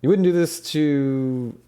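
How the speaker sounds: loud, high, drawn-out protest; acted indignant appeal. Fixer appealing to gang politics, indignant. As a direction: stretch out the challenge to their honour.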